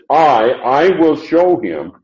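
"I, I" said high and insistent, "him" weighted too; emphatic I and him. Shown as a demonstration. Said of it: "the him is also emphatic".

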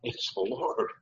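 quiet, understated aside; said quietly. Acted. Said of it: "he probably said that kind of quietly".

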